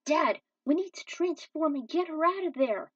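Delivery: high, loud pressing urge; urgent plea. A narrator's reading. Son urges his father to go rescue her. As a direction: push Dad to act now.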